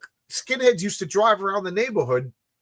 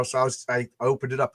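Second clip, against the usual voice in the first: ordinary delivery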